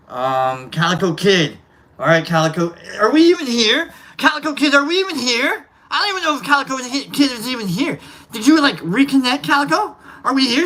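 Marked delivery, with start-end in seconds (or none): 3.0-10.6 higher, louder calls into the stream; calling out. Real, not acted. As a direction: call loudly for a missing viewer.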